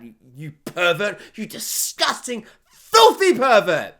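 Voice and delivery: mock outrage. Mock accusation of the viewer.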